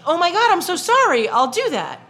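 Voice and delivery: high, gushing mock apology, easing down; imagined apologetic driver. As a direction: imitate an over-apologetic stranger. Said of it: "in my head he would go".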